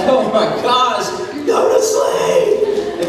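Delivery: slow, breathy laughing plea to go to sleep; half-laughing, worn-out parent; exasperated dad. Retold or imitated. A dad laughing at his kid who won't sleep, exasperated.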